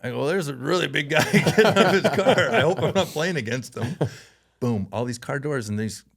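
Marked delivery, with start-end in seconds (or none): 0.3-4.0 high, louder mock worry; retold boyish alarm with a laugh in it; nervous kid. Twelve-year-old player telling his dad about big rivals, nervous mock alarm.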